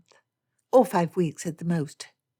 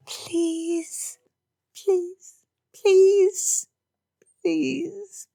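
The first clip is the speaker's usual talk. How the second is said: begging; 13 semitones higher, much slower than usual. Acted. Daughter begs her father, escalating high pleas. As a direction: wheedle each please higher.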